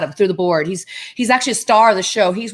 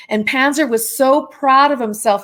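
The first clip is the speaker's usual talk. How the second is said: usual speaking voice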